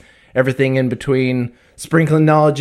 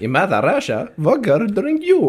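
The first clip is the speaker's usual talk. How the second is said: put-on Russian accent; 7 semitones higher than usual.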